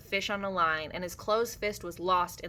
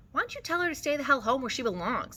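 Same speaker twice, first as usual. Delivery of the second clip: scolding; 7 semitones higher, faster than usual. A narrator's reading. Carlson joins the quarrel.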